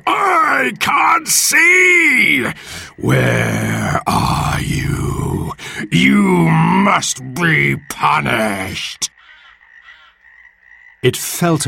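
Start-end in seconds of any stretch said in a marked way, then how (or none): 0.1-2.5 high, anguished drawn-out wail; thunderous yell. Acted. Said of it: "yelled in a voice that sounded like thunder". 3.0-9.1 slow, raging monster roar; thunderous yell. Acted. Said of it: "yelled in a voice that sounded like thunder".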